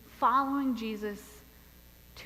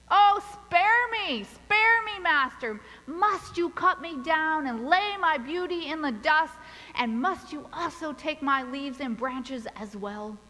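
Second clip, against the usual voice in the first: pleading bamboo; 4 semitones higher, 4 dB louder than usual. Acted. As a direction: beg the master for mercy, voice lifted. Bamboo begs the master.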